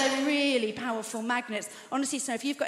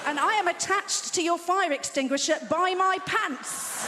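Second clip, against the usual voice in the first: higher, louder exasperated outburst; exasperated shout; 6 semitones higher, 3 dB louder than usual. Retold or imitated. Menopausal woman snapping at the doorman, exasperated shout. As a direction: blurt it out, fed up. Punchline shouted in retold rant.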